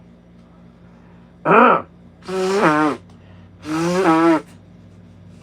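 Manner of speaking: loud harsh coughing; hacking cough after choking. Real, not acted. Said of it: "Talking and eating and drinking".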